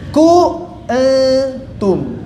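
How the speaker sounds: ku antum stretched very slowly, the nun hidden; drawn-out thin kuntum. Shown as a demonstration. Teacher slowly showing a thin kuntum. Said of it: "lebih jelas lagi ketipisannya".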